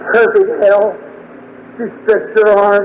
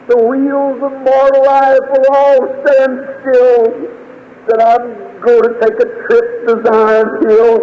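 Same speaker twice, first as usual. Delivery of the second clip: slow, drawn-out, higher-pitched recitation; fervent poetic preaching; 5 semitones higher, slower than usual. Acted. Preacher reciting his hope of heaven to the congregation, slow and fervent. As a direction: stretch each phrase like a solemn hymn verse.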